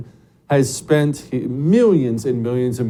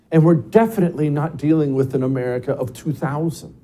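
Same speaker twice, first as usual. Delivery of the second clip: ordinary talk, nothing marked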